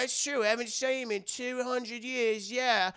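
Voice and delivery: mock pirate agreement; agreeing pirate rat. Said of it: "other rats are like".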